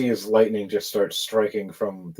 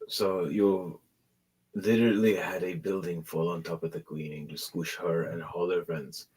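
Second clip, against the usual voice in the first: subdued leader; 4 semitones lower, 7 dB quieter than usual. Acted. Plays assassin leader marvelling.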